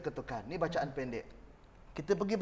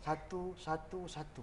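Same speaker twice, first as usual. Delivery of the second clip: softer, fading satu, satu, satu; soft counting; 10 dB quieter, slower than usual. Shown as a demonstration. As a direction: drop to a murmur as you count. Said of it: "satu intonasi bacaan". (kiraan perlahan dan lembut)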